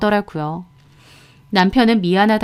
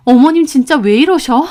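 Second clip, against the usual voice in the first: raised, higher exasperated voice; exasperated complaint; 5 semitones higher, 5 dB louder than usual. A narrator's reading. Wife phoning husband about his mother, exasperated.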